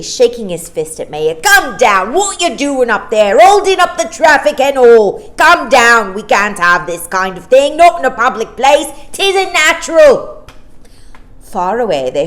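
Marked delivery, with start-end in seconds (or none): 1.4-10.5 loud, indignant policeman yelling upward; angry policeman shouting. Acted. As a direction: shout her down from the sky in outrage.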